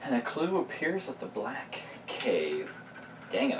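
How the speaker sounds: normal talking voice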